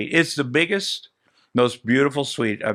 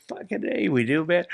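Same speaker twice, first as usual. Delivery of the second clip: eager yes; 6 semitones higher, 4 dB quieter than usual. Retold or imitated. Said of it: "We're like".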